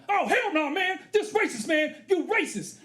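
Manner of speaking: high outraged yell; yelling friend. Retold or imitated. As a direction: shout down the cop. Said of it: "he started yelling".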